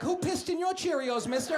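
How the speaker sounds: high, mock little kid voice; kid's voice. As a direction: squeak it like a cheeky little boy. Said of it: "his little kid was looking up at me like".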